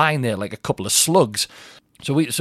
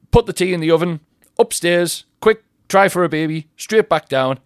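brisk orders; 6 semitones higher, 5 dB louder than usual. Retold or imitated. Rattles off hurried orders to himself.

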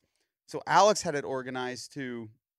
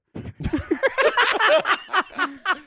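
big laugh; 7 dB louder than usual. Real, not acted. Laughing at the story.

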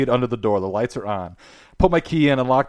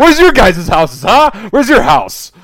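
high, loud challenge to the room; mock taunting; 8 semitones higher, 5 dB louder than usual. To friends who teased about dropping out, mock taunting. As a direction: throw it back as a playful jab.

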